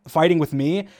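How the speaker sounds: ordinary talk, nothing marked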